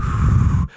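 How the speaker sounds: loud blow into the microphone; breathy fake wind gust; imitated wind. Shown as a demonstration. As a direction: blow hard, pretending to be wind. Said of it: "blowing into the microphone pretending to be the wind".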